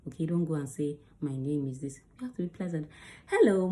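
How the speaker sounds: usual speaking voice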